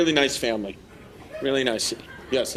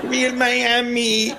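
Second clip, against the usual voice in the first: very slow, drawn-out, higher echo; mock echo; 4 semitones higher, much slower than usual. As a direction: stretch out each syllable in a put-on voice. Drawn-out mocking echo of crowd's phrase.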